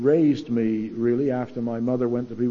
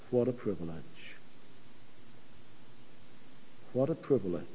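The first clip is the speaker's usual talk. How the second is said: much softer and lower, hushed and reverent; very quiet; 6 semitones lower, 12 dB quieter, much faster than usual. Retold or imitated. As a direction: say it softly, almost to yourself. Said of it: "in a very quiet voice".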